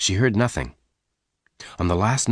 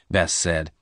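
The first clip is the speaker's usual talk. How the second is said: ordinary delivery, nothing marked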